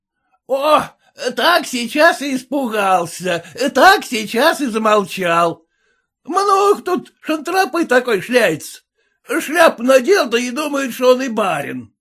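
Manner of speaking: high, jeering shout; mocking peasant. A narrator's reading. Red-faced peasant jeering at the husband, high mocking shout. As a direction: sneer at the gentleman's hat.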